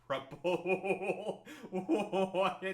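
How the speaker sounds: laughing through the words in disbelief; incredulous laughter, unscripted. Real, not acted. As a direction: laugh it out, then a stunned what. Laughing at an absurd post.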